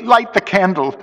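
high, shivering plea; teeth chattering. A narrator's reading. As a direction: beg for light through chattering teeth. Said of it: "I could hear his teeth chattering".

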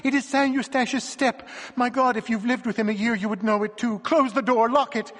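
high, loud, panicked pleading. A narrator's reading. Wife begging her companion to lock the door, high and panicked. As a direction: beg him to lock the door in terror.

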